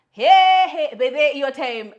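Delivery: high, very loud calling out; sudden high yell, easing off; loud outburst. Retold or imitated. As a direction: belt out a startled protest. Mock outburst while retelling a row.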